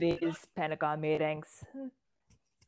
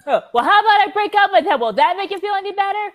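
high mocking sarcasm; loud, high sarcastic jab; 14 semitones higher, 11 dB louder than usual. Sarcastic offer to break up.

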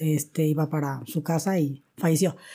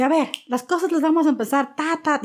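higher, brisk boss voice; taking charge; 9 semitones higher than usual. Retold or imitated. Young coordinator ordering the staff around, bossy. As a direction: take command of the room.

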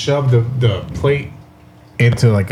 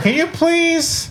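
squeaky high, slow plea; shrill mock victim voice; high annoyed woman; 4 dB quieter, much slower than usual. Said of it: "She turns around".

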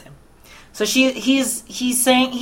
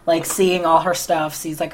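ordinary delivery

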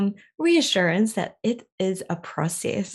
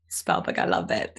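whisper. Real, not acted. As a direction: whisper it to yourself.

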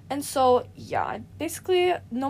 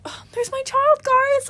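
much higher, louder, like a gushing mother; mock proud mom; 14 semitones higher, 5 dB louder, slower than usual. Pointing out Mason to the guys: mock proud mom. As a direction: announce it like a proud parent. Calling Mason her child.

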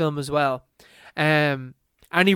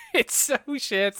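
slow, high, through real laughter; laughing; 5 semitones higher, 4 dB quieter than usual. Real, not acted. Co-host reacting to terrible accents, laughing. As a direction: let the words ride on a laugh.

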